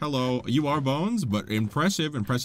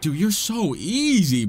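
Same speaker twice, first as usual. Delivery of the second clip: higher, drawn-out taunt; gloating taunt; 5 semitones higher, 4 dB louder than usual. Real, not acted. Player taunting the boss, drawn-out gloating. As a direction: stretch out EASY to gloat.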